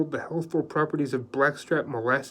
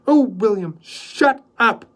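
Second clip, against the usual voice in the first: sharp, loud and higher snap; loud irritated snap at William; snapped; 9 semitones higher, 10 dB louder, slower than usual. A narrator's reading. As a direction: cut him off hard. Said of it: "Joy snapped".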